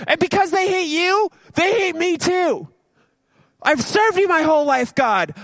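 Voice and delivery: very high, hammed-up self-pitying wail; whining lament. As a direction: whine to heaven like a drama queen. Elijah voiced wailing to God.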